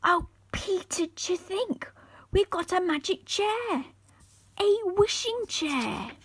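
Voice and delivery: put-on high, excited little-girl voice; thrilled girl. A narrator's reading. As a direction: gush with wonder over the chair. Molly thrilled by magic chair.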